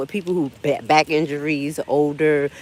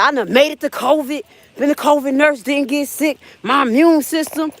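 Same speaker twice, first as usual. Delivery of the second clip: retold boastful self; 8 semitones higher than usual. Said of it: "And I was like".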